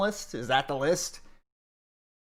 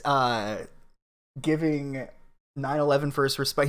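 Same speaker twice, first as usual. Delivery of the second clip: ordinary talk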